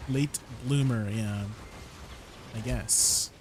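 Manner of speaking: usual speaking voice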